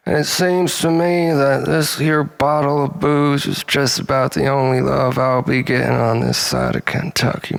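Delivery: put-on high, twangy Kentucky drunk lament; hammed-up Southern drunk crying into his bottle. Drunk hillbilly monologue.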